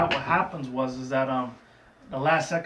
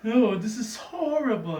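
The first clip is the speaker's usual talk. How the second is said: higher, slow, hammed-up Rarity wail; mock despair, drawn out like a fainting diva; playful imitation of a dramatic lady pony; 9 semitones higher, 4 dB louder, slower than usual. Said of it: "Rarity's like".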